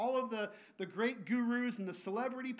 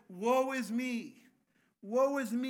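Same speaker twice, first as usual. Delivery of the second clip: anguished cry; 5 dB louder than usual. Retold or imitated. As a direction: cry out your ruin from the floor. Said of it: "falls on his face and says".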